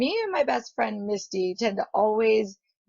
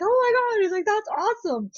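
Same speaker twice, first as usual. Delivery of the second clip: retold delighted amazement; 12 semitones higher than usual. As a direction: exclaim over a perfect present.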